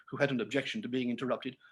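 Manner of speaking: ordinary delivery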